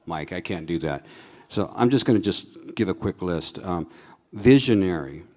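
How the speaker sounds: ordinary delivery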